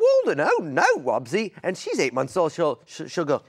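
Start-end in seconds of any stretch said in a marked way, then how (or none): 0.0-1.5 much higher cartoon character voice; voicing a Wubbzy character; cartoon male voice. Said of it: "I do".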